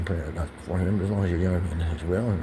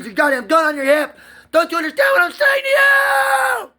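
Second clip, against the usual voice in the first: escalating yell, much higher and louder; angry outburst; 11 semitones higher, 9 dB louder than usual. Retold or imitated. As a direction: rage rising to a screamed plea. Retelling his outburst at the cop.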